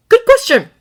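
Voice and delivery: high, loud frantic reply; excited. A narrator's reading. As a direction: blurt it out mid-fight. Frantic reply mid-fight.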